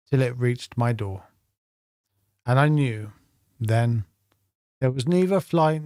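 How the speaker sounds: measured recital with long pauses between phrases; deliberate, phrase-by-phrase poetry reading; poem reading. A narrator's reading. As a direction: read the poem line by line, letting each phrase land. Reads a poem aloud.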